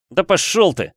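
angry shout. A narrator's reading. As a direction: shout it into the pillow in rage. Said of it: "прокричал я".

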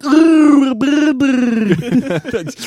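bubbling laugh through water; underwater laugh. Acted. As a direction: gargle a laugh like you're underwater. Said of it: "You do a really good underwater voice".

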